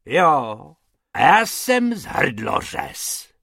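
a threatening shout; roared. A narrator's reading. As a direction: roar back at the rabbits. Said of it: "zařval pan Hrubec".

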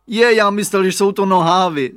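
put-on high, loud child's excuse; boy blurting out his mix-up; little boy's surprised voice. A narrator's reading. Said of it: "řekl Radovan".

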